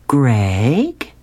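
drawn-out, rising query to husband; questioning wife. Acted. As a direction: call his name as a pointed question. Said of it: "she says".

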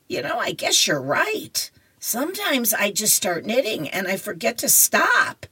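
high-voiced grandmother in a comic read-aloud; comic-strip grandma.

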